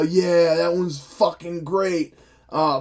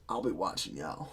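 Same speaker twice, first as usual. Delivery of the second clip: cop impression; 12 semitones lower, 15 dB quieter than usual. Mocking the suspicious cop at the movie's end, low and soft. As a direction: do the small-town cop's low warning. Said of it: "that's my impression of the cop".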